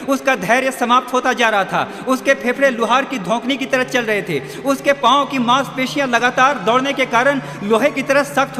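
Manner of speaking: urgent, driving race narration at full force; impassioned preaching. A narrator's reading. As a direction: build the race like a rousing preacher. (जोशीला, ज़ोरदार उपदेश)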